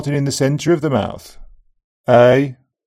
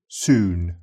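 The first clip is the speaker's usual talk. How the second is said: Brummie accent; 7 semitones lower than usual. Shown as a demonstration. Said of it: "In keeping with Northern English accents".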